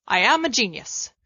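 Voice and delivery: usual speaking voice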